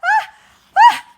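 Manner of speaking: ah, ah before a sneeze; building sneeze. Acted. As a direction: gasp into a sneeze. Elephant about to sneeze.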